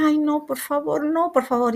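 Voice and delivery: imitating her own crying plea at the deathbed; retold tearful plea. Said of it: "me empecé a llorar y a llorar".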